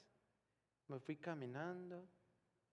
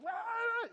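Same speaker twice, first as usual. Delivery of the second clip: grief-stricken scream; 7 dB louder than usual. Retold or imitated.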